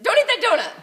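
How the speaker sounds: high, loud scolding shout; Plummer scolding. Retold or imitated. Coach Plummer scolding Tessa about her donut, high and loud. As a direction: bark the order like a coach. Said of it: "And then he's like".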